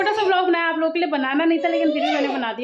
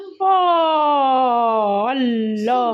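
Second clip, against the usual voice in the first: long, falling "oh" of amazement; drawn-out exclamation; 3 semitones lower, much slower than usual. Real, not acted. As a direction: react with stretched-out astonishment. Mother reacts to child's chatter.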